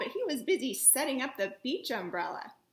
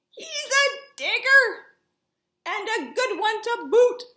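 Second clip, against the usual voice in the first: yelling pirate; 8 semitones higher, 9 dB louder than usual. A narrator's reading. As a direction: call back to the crew with glee. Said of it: "then yelled back to his crew".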